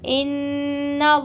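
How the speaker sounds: इन्नल्लाह with a held nasal on the doubled n, then a heavy lam; tajweed demo of इन्नल्लाह; nasal ghunna and heavy lam. Shown as a demonstration. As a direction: recite इन्नल्लाह with ghunna on नून and a thick lam. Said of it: "गुन्ना करेंगे और अल्लाह के लाम को पूर पढ़ेंगे".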